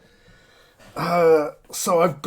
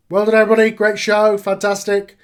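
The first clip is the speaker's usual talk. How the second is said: louder, higher congratulations to everyone; booker's loud praise; 6 semitones higher, 8 dB louder than usual. Acted. Booker congratulates the roster after the show, calling out. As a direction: call out praise across a locker room.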